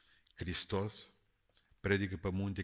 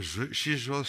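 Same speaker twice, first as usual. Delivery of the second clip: ordinary delivery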